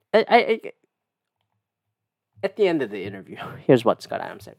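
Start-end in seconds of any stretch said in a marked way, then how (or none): none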